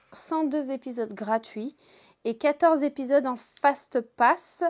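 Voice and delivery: ordinary talk, nothing marked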